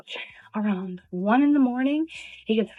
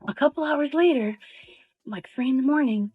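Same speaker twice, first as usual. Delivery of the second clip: ordinary talk, nothing marked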